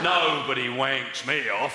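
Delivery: slow, menacing quote of the bloke; lightly voiced menacing driver; slow mock tough guy. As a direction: drag it out like a hard man. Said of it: "He said".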